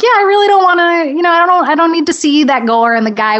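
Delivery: high-pitched, rushed protest settling down; high, reluctant mock protest; acted squeamish refusal. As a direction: beg off in a high voice, easing back down. Acted reply to people urging her.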